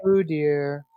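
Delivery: drawn-out parrot imitation of a woman's greeting; mock-formal, lingering hello; Carrie's parroted greeting. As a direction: stretch the greeting like a mimicking bird. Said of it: "he even talks in Carrie's voice".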